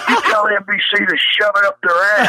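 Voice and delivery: hammed-up heel promo rant; wrestling promo. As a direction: spit out the promo at the network. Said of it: "I'm good at it, right?".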